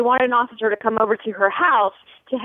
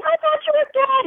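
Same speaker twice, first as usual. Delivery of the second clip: high mock scream of the wife; wife screaming at her husband, in a high mock scream; retold scream. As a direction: shriek it like a frantic wife. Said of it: "She's screaming".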